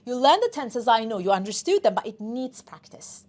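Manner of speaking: ordinary talk, nothing marked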